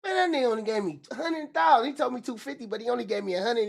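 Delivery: high "What?" then fast indignant complaint; outraged disbelief. Retold or imitated. Jailed rapper learning he was shorted, fast and indignant. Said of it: "you like".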